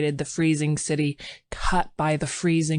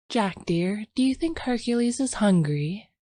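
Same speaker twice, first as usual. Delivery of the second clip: put-on lightly raised, gentle young wife voice; young woman; 6 semitones higher than usual. Sylvia's voice.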